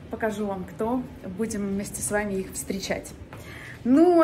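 ordinary talk

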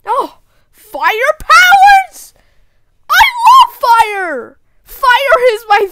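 high, loud, drawn-out thrill; excited delight. Acted. Seeing fire powers, loudly delighted. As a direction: burst out gleefully, stretching the words.

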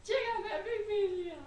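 laughing through the words; giggling while talking. Real, not acted. Laughing while showing the watermelon.

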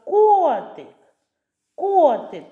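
«Котик» called high and loud, long stressed о; calling out. Shown as a demonstration. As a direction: call the kitty, KOOO-tyk in «Котик». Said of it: "Давайте покличемо котика". (гучно кличе, протяжне «о» в слові «Котик»)

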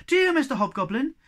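excited plea. Acted. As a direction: beg eagerly, full of hope. Moomin Troll eagerly wishing.

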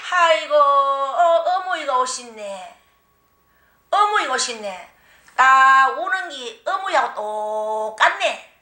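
slow, drawn-out wailing spirit speaking; possessed old spirit voice. Retold or imitated. Spirit in her body greets her mother, slow and wailing. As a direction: let the spirit moan through you, slowly.

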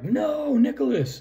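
high, drawn-out imitation of a girl crying out; mock horrified cry. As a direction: cry out in a high voice without shouting. Voices girl as he jumps.

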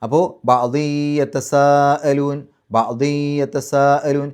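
ബഅ്ദി യതസാഅലൂൻ with the nun merged and nasalised into ya, slow; nasal merging. Shown as a demonstration. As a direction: chant ബഅ്ദി യതസാഅലൂൻ slowly with ghunna. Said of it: "തൻവീനിനെ യായിലേക്ക് ലയിപ്പിക്കുക, മണിക്കുക".

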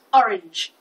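sudden loud one-word yelp. A narrator's reading. Florist suddenly thinks of a colour, yelping. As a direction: burst out with it as it hits you. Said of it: "she yelped".

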